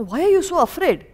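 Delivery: normal talking voice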